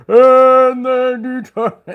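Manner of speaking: high, loud, slow wail breaking into a laugh; overplayed hellfire voice; mock doom preacher. Mocking preachers, laughing through the wail. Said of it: "they talk like that too".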